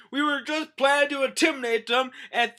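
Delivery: high, loud whiny voice; panicky Usopp. Retold or imitated. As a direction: plead your innocence in a panic. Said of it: "Usopp's like".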